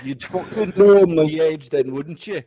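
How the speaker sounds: put-on northern English accent; imitation northern English voice. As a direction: talk like someone from northern England. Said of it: "if I had a fucking northern English accent".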